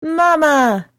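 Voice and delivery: high, eager cry for mother; a boy's joyful shout home; excited call. Acted. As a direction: call out to Mama from afar. Boy runs home calling mother.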